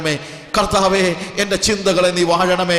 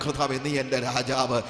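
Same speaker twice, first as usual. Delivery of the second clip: quiet prayer; 6 semitones lower, 8 dB quieter than usual. Shown as a demonstration. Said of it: "ഹൃദയത്തിന്റെ ഉള്ളിൽ നിന്ന്".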